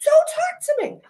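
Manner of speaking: retelling yelling at pushy cabbies at the airport; screaming at cabbies. Retold or imitated. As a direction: yell them off in a high strained voice. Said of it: "we're screaming at each other".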